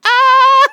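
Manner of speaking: high, loud startled "Ah!"; mock alarmed yelp. Healthcare worker reacting to the hopeful forecast, a loud high yelp. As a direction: yelp as if caught off guard. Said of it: "I was like".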